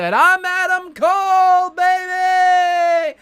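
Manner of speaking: high, loud, slow hyped wrestler catchphrase; milk it for the whole crowd; big catchphrase. Retold or imitated. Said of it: "And he said".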